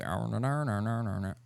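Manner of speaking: mumbo-jumbo Southern twang; mock Southern accent. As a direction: imitate a friend's Southern accent as garbled noises. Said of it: "he does a really good Southern accent".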